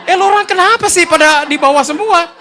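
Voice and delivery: high, loud exasperated call; retold indignant shout. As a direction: act out yelling at the group downstairs. Indignant shout to colleagues downstairs.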